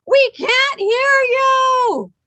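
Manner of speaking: high, slow, loud taunting call; kids yelling. Retold or imitated. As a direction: yell it out like a whole class of kids. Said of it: "all of my classmates".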